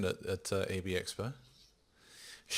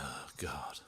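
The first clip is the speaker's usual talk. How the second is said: whispered dismay; 7 semitones lower, 11 dB quieter than usual. Retold or imitated. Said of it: "and I was like".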